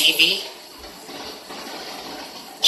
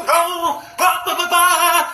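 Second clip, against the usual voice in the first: mock pastor talk; 14 semitones higher, much slower than usual. Mimicking how a pastor talks.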